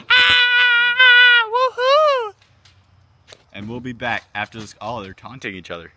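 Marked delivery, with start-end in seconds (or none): 0.1-2.3 very high, loud whoop; excited cheer. Acted. Crowd is asked who is ready, high wild cheer.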